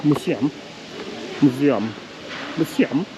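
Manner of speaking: MUSEUM tried as a German says it, moo-see-um; attempted German Museum, oo in the first syllable; German pronunciation. Shown as a demonstration. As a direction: practise Museum with German sounds, word by word. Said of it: "I'm trying to pronounce it as a German".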